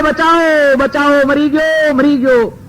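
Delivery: high pretend screams for help; faked cries for help. Disciple faking agony before his family, high staged screams. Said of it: "રાડો પાડવા માંડ્યો".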